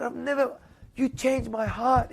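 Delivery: slow down and let the feeling break through; retold tearful thanks. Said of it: "And he was crying".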